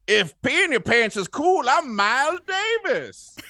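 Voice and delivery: high, put-on old lady; squeaky old lady from the movie. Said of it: "an old lady says that in the movie".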